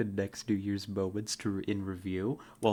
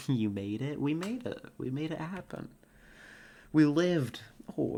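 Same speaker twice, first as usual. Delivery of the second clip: ordinary talk, nothing marked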